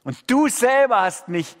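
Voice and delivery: hoch und laut, Gott anklagend; anklagende Klage. Shown as a demonstration. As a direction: wirf Gott die Klage laut entgegen, am Ende fast geschrien. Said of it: "Lobpreis im Sinne vom Alten Testament geht so". (Prediger zeigt die Psalmklage: hohe, laute Anklage an Gott)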